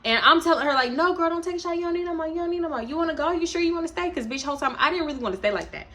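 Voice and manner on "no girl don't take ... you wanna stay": pitched way up, pleading; high pleading voice. Retold or imitated. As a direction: plead with her high and fast. Urging drunk friend to stop.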